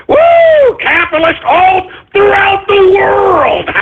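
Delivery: excited whoop. Real, not acted. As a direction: cheer it out, celebrating. Host celebrates caller partying.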